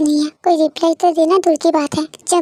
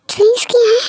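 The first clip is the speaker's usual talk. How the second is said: shocked question; 7 semitones higher, 3 dB louder than usual. Acted. Tae discovers he has been drinking.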